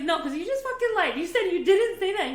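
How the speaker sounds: high, loud retold outburst; hammed-up heated argument voice; mock angry accusation. Retelling how she accused Luis of lying, loud mock fury. Acted quarrel with partner.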